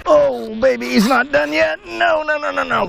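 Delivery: high, urgent no no no; excited pleading. Real, not acted. Angler pleading with a fighting snook, high and urgent. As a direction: beg the fish not to get away.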